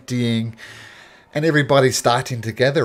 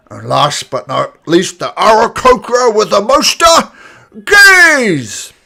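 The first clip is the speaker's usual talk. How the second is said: put-on booming old orc addressing the crowd; grand proclamation, slow and loud; elderly orc announcer; 10 semitones higher, 13 dB louder than usual.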